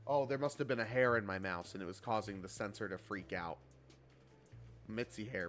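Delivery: ordinary talk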